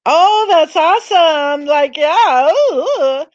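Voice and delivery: high, loud gushing fan voice; imitating a bubbly host; gushing YouTuber. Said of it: "she can say".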